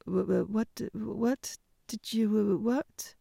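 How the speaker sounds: tongue-tied stutter on each what; stammering. A narrator's reading. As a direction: stammer it out, flustered. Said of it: "he stammered".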